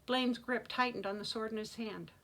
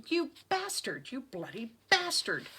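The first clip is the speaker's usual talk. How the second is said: angry shout; 4 semitones higher, 3 dB louder than usual. A narrator's reading. Son confronting his father with a sword, angry shout. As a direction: hurl the insult, stretching the words. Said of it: "Blaine shouted".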